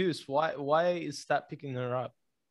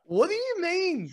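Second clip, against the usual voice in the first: much higher and slow, disbelieving; incredulous; 13 semitones higher, slower than usual. Real, not acted. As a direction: drag out the question in shock. Listener reacting to the story.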